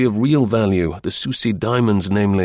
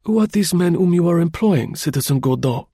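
high, sudden questioning voice; abrupt question; 9 semitones higher than usual. A narrator's reading. Chauvelin pressing Gourdon about his men, abrupt and high. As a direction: cut in abruptly with a probing question. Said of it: "he resumed abruptly".

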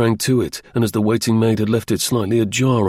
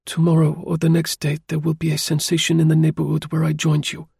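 voiced higher, measured and serious; a young French lady hinting at trouble; woman character, grave; 6 semitones higher than usual. A narrator's reading. Lady warning the driver of a coming sensation, grave.

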